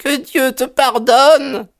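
high, drawn-out lament; anguished cry. A narrator's reading. Mother throwing up her arms at her daughter, crying out. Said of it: "s'est écriée madame Charpentier".

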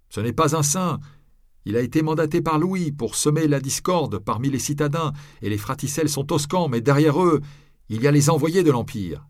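a townsman rails against the condemned man; voiced crowd accuser. A narrator's reading. Onlooker in the crowd denounces the friar, loud and high.

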